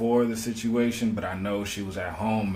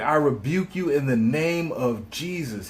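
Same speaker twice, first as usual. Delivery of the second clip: a lightly voiced churchy rebuke, a bit higher; rebuking in Jesus' name; 5 semitones higher than usual. Retelling Sonya's words to the officer, mild churchy rebuke. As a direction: retell her prayerful rebuke with mild fervor. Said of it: "She was like".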